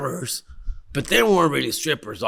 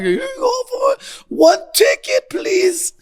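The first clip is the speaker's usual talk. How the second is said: mock polite; 3 dB louder, slower than usual. Handing over raffle money, high voice.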